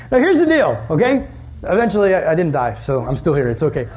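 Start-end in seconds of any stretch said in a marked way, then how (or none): none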